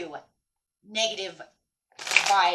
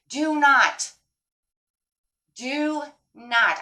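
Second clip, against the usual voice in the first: heavy stress on "do not", louder and higher; emphatic warning; 6 semitones higher, 7 dB louder, much slower than usual. Real, not acted. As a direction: punch both words of "do not", pausing between repeats. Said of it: "I cannot emphasize this enough".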